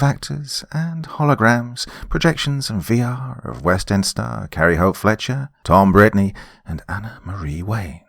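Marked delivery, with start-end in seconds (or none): none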